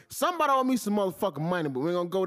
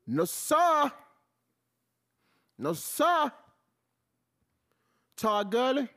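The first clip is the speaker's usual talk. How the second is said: emphatic refusal; 7 semitones higher, much slower than usual. Real, not acted.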